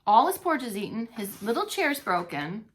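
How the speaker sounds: ordinary delivery, nothing marked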